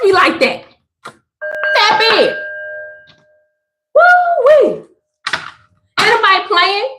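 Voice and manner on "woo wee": excited high whoop; high woo-wee. Real, not acted. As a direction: whoop it like you're fired up. Excited exclamation.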